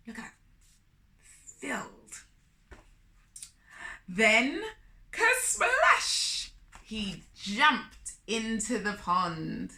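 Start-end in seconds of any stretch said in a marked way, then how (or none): 5.1-6.5 high, loud kersplash; big kersplash. A narrator's reading. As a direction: make a big splash of it. Splash sound word.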